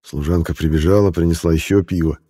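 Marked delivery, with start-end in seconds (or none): none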